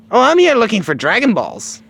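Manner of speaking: put-on high, loud kid voice; Goku. Goku telling the Ox King his errand, high and loud. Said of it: "He says".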